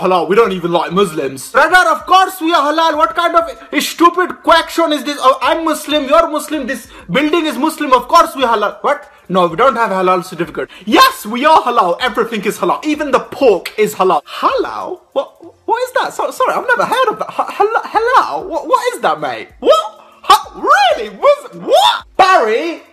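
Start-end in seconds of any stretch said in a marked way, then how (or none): 1.5-14.2 put-on lightly accented high shopkeeper; indignant shopkeeper, a touch put on. 14.2-22.8 high, laughing mock confusion; mock surprise. Shopkeeper feigning ignorance.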